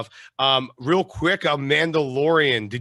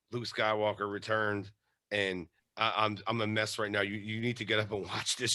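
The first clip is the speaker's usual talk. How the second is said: low, soft and subdued; hushed bedside voice; 6 semitones lower, 10 dB quieter than usual. Retold or imitated. Telling sleeping wife the news, hushed and low. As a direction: keep it down, as if waking a sleeper.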